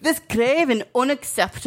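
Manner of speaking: high-pitched mock English accent, sounding Irish; lilting, unconvincing English accent; attempted English accent. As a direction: read it in a bad Yorkshire accent. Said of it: "your English accent is your Irish accent".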